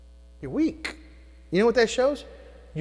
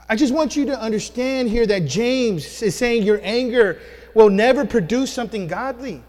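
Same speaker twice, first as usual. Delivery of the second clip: ordinary talk, nothing marked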